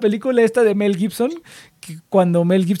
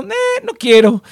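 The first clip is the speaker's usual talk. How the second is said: high, slow, whiny "no quiero"; mock-lazy brush-off, drawn out; lazy refusal; 12 semitones higher, 4 dB louder, slower than usual. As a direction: drag it out like you can't be bothered. Acting out his own lazy reaction.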